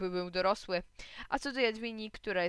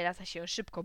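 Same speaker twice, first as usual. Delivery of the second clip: plain reading voice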